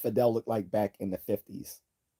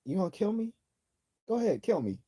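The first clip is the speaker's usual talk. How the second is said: 1950s Fidel Castro; 10 semitones higher, faster than usual. Retold or imitated. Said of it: "imagine that in a Cuban accent".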